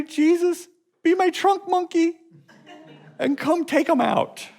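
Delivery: high, pleading hammed-up prayer; mock whiny prayer.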